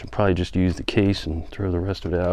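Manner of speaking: ordinary talk, nothing marked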